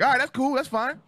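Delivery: high, chirpy compliance; eager kid agreeing. Retold or imitated. Kid told he gets new clothes, eagerly agreeing. Child happily agreeing with mom.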